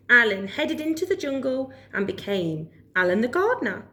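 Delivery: ordinary delivery, nothing marked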